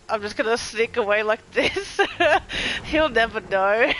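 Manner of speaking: loud giggling gloat, slowing at the end; giddy laughing whisper-gloat gone loud. Real, not acted. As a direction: laugh through the sneaky escape. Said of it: "I'm kinda laughing right now".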